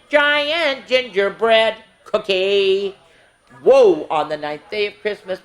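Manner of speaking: lively sing-song counting rhyme; reading to children. Acted. Miss Tasha reading a Christmas counting book to kids, sing-song. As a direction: read it out brightly, verse by verse for the little ones.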